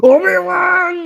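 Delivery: high, slow wailing cry; anguished cry. Pretend. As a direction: wail the name in defeat. Defeated cry out "Obi-Wan.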